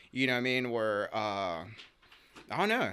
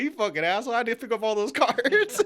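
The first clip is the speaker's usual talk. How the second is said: high, loud laughing curse; laughing mock insult; 11 semitones higher, 7 dB louder than usual. Cart collector cursing his friend, laughing. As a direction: cuss him out while cracking up.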